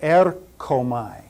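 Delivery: Erchomai said slowly in Greek, air-KHO-my; Erchomai, slow careful Greek; Greek pronunciation. Shown as a demonstration. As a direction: sound out Erchomai with a throaty kh. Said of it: "Greek vocabulary drill".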